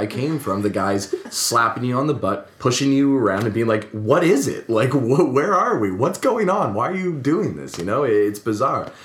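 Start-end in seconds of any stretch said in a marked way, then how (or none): 3.9-7.8 high, bewildered bro questions; mock dumb jock, pitched up and confused. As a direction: play the lost, baffled jock.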